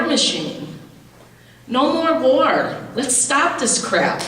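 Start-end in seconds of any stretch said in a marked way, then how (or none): 1.7-4.2 rally the room, slow and rising; protester's outcry at the nuclear hearing; emphatic protest cry. Real, not acted.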